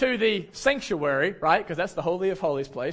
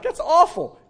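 much higher and louder mock outrage; shocked reaction; 10 semitones higher, 7 dB louder than usual. Imitating the audience reacting to the story, higher and louder in mock outrage. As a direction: gasp out the audience's imagined dismay. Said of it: "Some of you are like".

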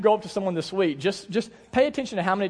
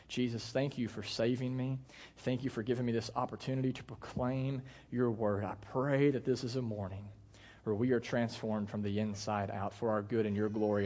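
softer, hushed prayer to the Father; 7 semitones lower, 8 dB quieter than usual. Acted. Pastor praying for his congregation, softly. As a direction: pray gently over the congregation.

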